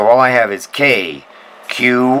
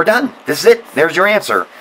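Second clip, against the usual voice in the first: excited; 8 semitones higher than usual. Real, not acted. Solved the problem, triumphant.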